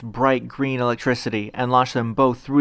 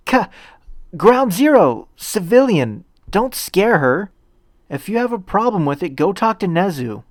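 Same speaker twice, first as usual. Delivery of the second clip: loud, higher scolding shout; angry outburst; 8 semitones higher, 4 dB louder than usual. Acted. Deku shouting at Ground Zero to stop scaring the civilian, angry and loud. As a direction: snap at him to back off. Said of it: "Deku's outburst".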